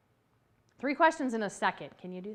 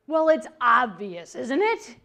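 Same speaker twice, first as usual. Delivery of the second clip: 1 mock impatience; 7 dB louder, slower than usual. Mocking an impatient know-it-all.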